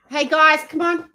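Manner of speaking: high, loud call to the dog; firm high command to settle Lulu. Real, not acted. To her excited dog Lulu, a high loud call. Telling the dogs off.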